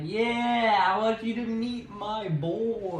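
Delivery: high, drawn-out proud announcement; Homelander. Retold or imitated. Homelander presents his son at the rally, high proud announcement. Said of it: "we end with Homelander showing his son".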